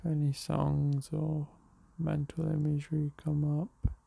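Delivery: unhurried meditation voice with long pauses; guided meditation. Real, not acted. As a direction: guide them gently into the breath, leaving space between phrases. Leads a morning meditation.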